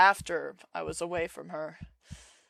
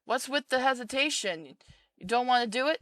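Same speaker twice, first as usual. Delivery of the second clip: a raised, pushy mother voice; controlling mother; 6 semitones higher than usual. Acted. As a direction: lean in as the smothering mother. Mother pressing her child.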